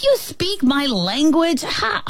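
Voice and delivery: high-pitched amazed questioning; astonished exclamation. Acted. Ant learning the fly speaks its language, astonished and high. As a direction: let disbelief push the voice way up. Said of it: "the ant exclaimed".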